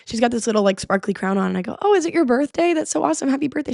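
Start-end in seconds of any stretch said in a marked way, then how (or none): 1.8-3.7 cheery. Retold or imitated. Cheerful chat with stranger.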